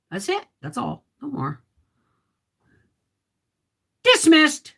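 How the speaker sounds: sudden loud, high mock command; mock dismissal. Jokingly dismissing her chat like a stern boss, loud. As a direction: bark it like a drill sergeant ending class. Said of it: "Just kidding".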